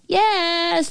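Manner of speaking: high, held "yes"; girl's call from afar; shouting. A narrator's reading. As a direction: call it back high and long. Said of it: "the little girl's shout came again".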